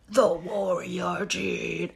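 slow, low epic trailer voice; drawn out like a legend's title; dramatic announcer voice. Pretend. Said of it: "I can't not say it in that voice".

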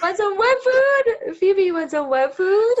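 high, loud sing-song coaxing; coaxing the dog. Pretend. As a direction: lure a lost pet with treats.

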